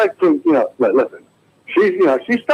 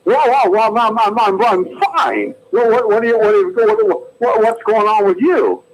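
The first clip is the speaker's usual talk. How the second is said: Jimmy Stewart; 6 semitones higher than usual. Acted. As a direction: stutter and hem like a bashful hero. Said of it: "here's my impression of Jimmy Stewart".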